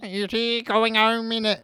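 high, slow, loud alien drawl; high alien impression with innit. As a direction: stretch it out like a little alien. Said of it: "That's how E T speaks".

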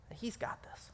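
soft whispered reassurance; hushed, intimate promise. Real, not acted. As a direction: breathe it out as a whisper.

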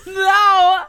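high, loud mock wail of no; mock protest. Real, not acted. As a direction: protest in playful horror.

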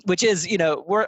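normal talking voice

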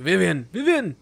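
loud, high urgent call. Acted. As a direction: shout the name to get through to someone. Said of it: "I'm calling to you".

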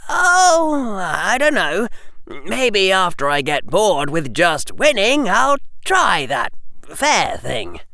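high, innocent schoolboy; Harry voice. Acted. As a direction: play the innocent.

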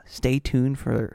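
usual speaking voice

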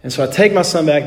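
usual speaking voice

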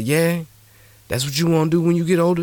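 high-pitched, lightly acted dad's question; questioning father. Father asking his boy about his ambition, lightly questioning. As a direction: play a dad checking what his son means.

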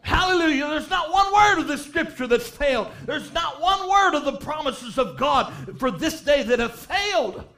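loud, high, building sermon cry; full-force revival preaching; fiery preaching. Real, not acted. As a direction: preach it with everything.

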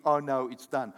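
ordinary delivery

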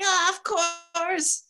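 high, drawn-out revelation; acted-out eureka, slow and high-pitched; sudden realisation. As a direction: stretch it out as the obvious answer dawns. Acts out her moment of realisation.

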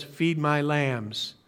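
Jesus gently charging Peter, soft and slow; gentle command. Retold or imitated. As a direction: hand it over tenderly, slowly.

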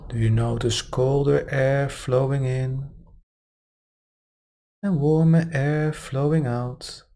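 calm, unhurried meditation guide; guided meditation. Acted. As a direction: ease them gently into relaxation. Meditation guidance resumes after aside.

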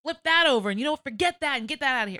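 loud, high, puffed-up rant; arrogant outburst. Retold or imitated. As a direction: bluster like a petty little god. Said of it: "to act as little gods and be like".

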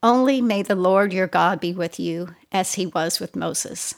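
ordinary delivery

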